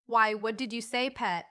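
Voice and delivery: ordinary delivery, nothing marked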